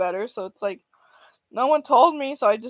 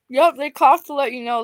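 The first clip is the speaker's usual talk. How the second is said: ordinary talk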